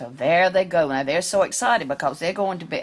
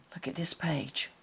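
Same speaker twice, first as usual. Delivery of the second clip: whisper; 3 semitones lower, 13 dB quieter than usual. Real, not acted. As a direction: whisper it so nobody else hears. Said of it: "aside to child".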